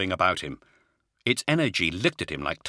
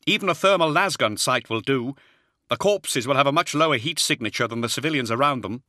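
higher-pitched, firm voice for a briefing; lightly voiced warrior giving advice; brisk in-character military advice; Zahariel advising officers; 7 semitones higher, 4 dB louder than usual.

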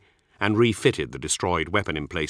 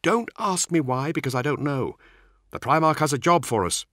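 higher-pitched squad commander's voice; brief the men in a raised character voice; narrator's higher voice for an officer; Nemiel; 7 semitones higher than usual. Acted.

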